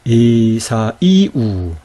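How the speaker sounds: very slow 에사이우, 이 lifted apart from 사; drawn-out 에사이우, 사 and 이 unglided; stressed, vowels separated. Shown as a demonstration. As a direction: stretch each vowel of 에사이우, accenting 이. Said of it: "다르죠, 그쵸?".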